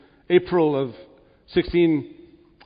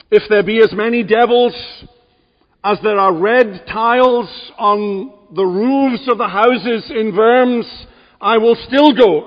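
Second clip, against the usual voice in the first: louder, higher defiant declaration; preaching at full force; 5 semitones higher, 6 dB louder than usual. Acted. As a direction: proclaim the vow like a battle cry. Preacher voices Luther's defiant vow.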